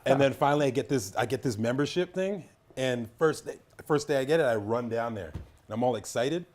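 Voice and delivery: normal talking voice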